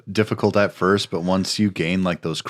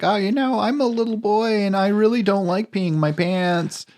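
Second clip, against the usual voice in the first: high-pitched impression of a child actor; little boy; 13 semitones higher than usual. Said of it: "child acting ... they're just like".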